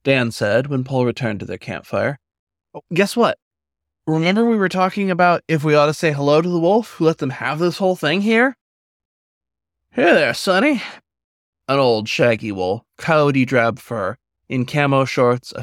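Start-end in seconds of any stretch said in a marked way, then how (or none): 2.8-8.6 higher, quick excited chatter; eager Dan. Acted. Dan tells Paul the news at camp, eager and high. 9.9-10.8 put-on high, friendly old-timer hello; a kindly old drifter calling out; old wolf, folksy greeting. Old wolf greets the pup at the campfire, folksy and high.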